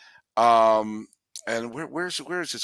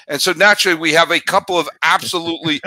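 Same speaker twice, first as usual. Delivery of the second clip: loud, fast, hammed-up excited burst after promising calm; shouting with put-on excitement; mock outburst; 5 semitones higher, 9 dB louder, faster than usual. Host breaking his own keep-it-calm promise, loud and excited.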